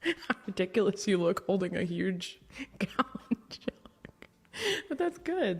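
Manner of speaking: whispering through giggles; breathless laughing whisper. Real, not acted. Laughing at gallon jug video.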